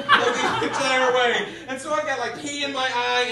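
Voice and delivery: ordinary talk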